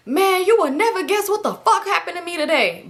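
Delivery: high-pitched exasperated retelling; irritated. Retold or imitated. Boyfriend venting about his day, high and irritated. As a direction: imitate him worked up and exasperated. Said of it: "But, like, so irritated".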